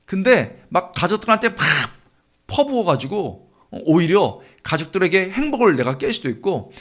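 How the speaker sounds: ordinary talk, nothing marked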